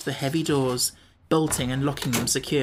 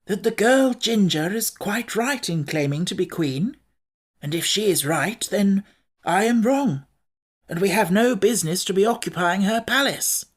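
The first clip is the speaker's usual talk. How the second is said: Scarecrow voice; 5 semitones higher than usual. A narrator's reading.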